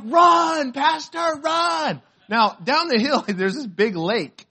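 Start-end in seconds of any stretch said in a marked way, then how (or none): 0.0-2.0 high, loud call to run; mock urgent shout. Missionaries calling to their stung pastor, urgent mock shout. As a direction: holler it like onlookers cheering someone's escape. Acting onlookers calling out to him.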